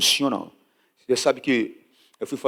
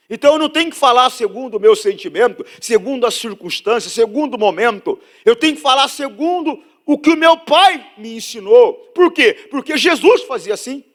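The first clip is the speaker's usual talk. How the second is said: loud, high-pitched preacher driving the point home; full-force preaching; 11 semitones higher, 8 dB louder than usual. Real, not acted. (pregação inflamada, em voz alta)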